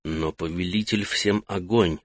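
hushed urgent reply; soundless whisper. Acted. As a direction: breathe it out without sound. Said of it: "Так же неслышно ответил". (едва слышный ответ)

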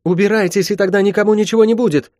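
high, fierce, urgent threat; high-pitched defiant command; defiant warning. Acted. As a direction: cry the warning out high and fast. Girl warns the intruders.

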